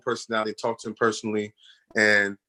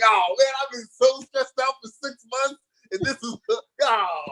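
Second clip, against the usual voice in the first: high, slow, breaking into a laugh; relieved laugh-cry; 13 semitones higher, slower than usual. Retold or imitated. Telling how he was stressed out about the dog, laughing high with relief. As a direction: let the relief spill out. Relief after six months' worry.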